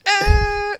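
loud, high buzzer noise; high held mock buzzer; wrong-answer buzzer. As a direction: imitate a game-show buzzer. Buzzer noise before saying no.

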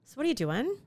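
every line ends on a rising lilt; up talk. Shown as a demonstration. Demonstrating an up talker for her co-host, rising tune. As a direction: make it sound like a question. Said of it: "So they talk like this all the time?".

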